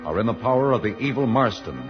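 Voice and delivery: normal talking voice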